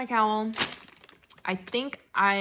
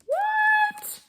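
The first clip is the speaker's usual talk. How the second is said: very high, loud squeak of surprise; astonished squeal; 6 dB louder than usual. Real, not acted. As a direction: squeal it in real disbelief. Opens a surprise extra gift.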